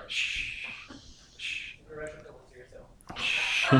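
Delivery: soft unvoiced shh; quiet shush; whispered shush. Real, not acted. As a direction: hush the room gently. Hushing the congregation.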